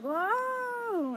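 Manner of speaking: sudden high-pitched whoa of alarm; mock Goblin caught off guard; startled whoa. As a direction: yelp it like you've been thrown back. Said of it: "and Goblin's like".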